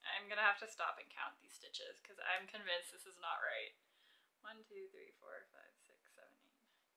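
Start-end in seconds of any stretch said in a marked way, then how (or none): 4.4-6.9 counting under the breath, fading away; murmured recount dying to nothing; whispered counting. Real, not acted. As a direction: whisper the numbers, softer and softer.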